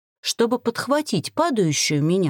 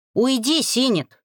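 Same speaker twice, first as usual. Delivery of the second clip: higher, stern order; forceful, raised-pitch dismissal; menacing growl; 5 semitones higher than usual. A narrator's reading. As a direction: growl a threatening command. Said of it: "грозно рыкнул бородатый".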